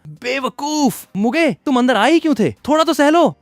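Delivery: tearful, worried telling-off; scolding. A narrator's reading. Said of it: "मुझे डांटते हुए कहा". (ऊँची आवाज़ में डाँटते हुए)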